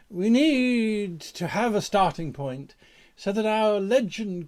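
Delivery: loud, slow, drawn-out theatrical proclamation; high-flown, booming storyteller delivery; grand mythic oratory. Acted. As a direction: declaim it like a legend, stretching key words.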